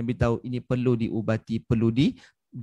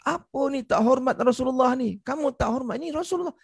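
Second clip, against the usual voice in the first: angry companion; 10 semitones higher than usual. Retold or imitated. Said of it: "Sahabat marah".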